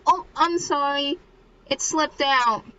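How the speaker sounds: high, lightly put-on apologetic girl; lightly acted apology. Girl apologising to Mika for mentioning Genta, lightly acted sheepishness. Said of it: "She's like".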